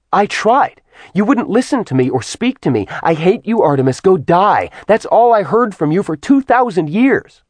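high, heated, rushing outburst; angry retort. A narrator's reading. Artemis glares and answers his accusation, angrily indignant.

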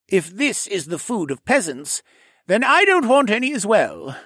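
haughty, high, nasal-free sneer; snooty voice. Acted. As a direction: look down your nose at them. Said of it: "the rogue said in a snooty voice".

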